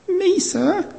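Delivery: tiny high squeak, nervous pupil; squeaky little voice. Retold or imitated. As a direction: squeak it out like a scared child. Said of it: "in a squeaky little voice".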